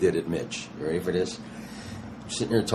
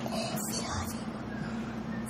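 hushed aside; 12 dB quieter, much slower than usual. Retold or imitated.

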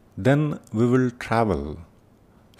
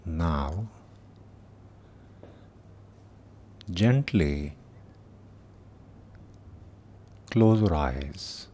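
slow meditation voice with long pauses; guided meditation; 2 semitones lower, 5 dB quieter than usual. Acted. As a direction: guide them gently through the body, unhurried.

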